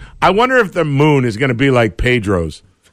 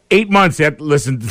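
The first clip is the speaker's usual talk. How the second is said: ordinary talk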